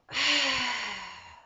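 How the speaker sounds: long breathy sigh out; audible exhale sigh. Shown as a demonstration. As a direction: sigh it all out. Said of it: "sigh it out".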